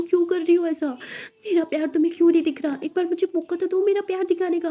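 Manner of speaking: high, loud, anguished outburst; angry yelling. A narrator's reading. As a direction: cry out in obsessive, jealous frustration. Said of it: "boss चिल्लाते". (गुस्से और तड़प में चिल्लाते हुए)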